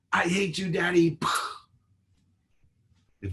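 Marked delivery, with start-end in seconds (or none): 0.1-1.2 put-on much higher, louder teen wail; daughter's outburst. Daughter yelling at her father, mock hurt outburst.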